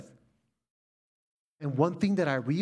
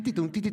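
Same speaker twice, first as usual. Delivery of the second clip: higher, chiming ting sounds; playful higher cowbell imitation; 6 semitones higher, much faster than usual. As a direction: ring it out like a cowbell. Imitating cowbells at family party.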